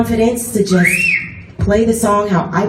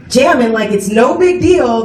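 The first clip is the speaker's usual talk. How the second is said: usual speaking voice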